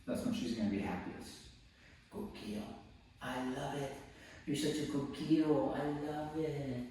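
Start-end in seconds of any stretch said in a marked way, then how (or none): 2.1-6.9 soft tender praise. Real, not acted.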